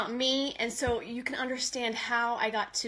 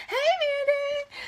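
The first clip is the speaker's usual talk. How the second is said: high, louder, slow hey; excited greeting; 6 dB louder than usual. Real, not acted. Host greeting Mandy, high louder hey. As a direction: sing out a welcome.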